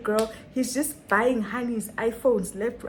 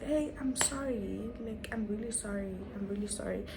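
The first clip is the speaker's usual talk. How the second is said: hushed, meek apology; soft apology; 8 dB quieter than usual. Retold or imitated. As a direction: apologise softly, too tired to argue. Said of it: "And I'm like".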